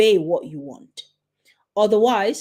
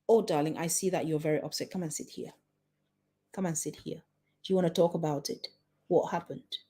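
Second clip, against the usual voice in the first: softer, tender comfort for an upset daughter; 3 semitones lower, 4 dB quieter, faster than usual. Shown as a demonstration. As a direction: soothe her gently, calm and caring. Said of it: "you are changing the way that you're talking".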